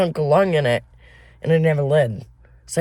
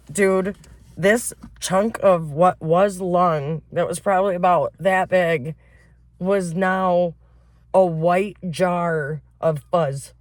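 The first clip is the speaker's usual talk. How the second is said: ordinary talk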